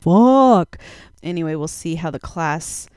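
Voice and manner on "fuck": one loud burst of frustration; sudden loud fed-up swear; exasperated curse. Acted. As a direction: blurt it out at the empty bin. Backpack stolen on the train.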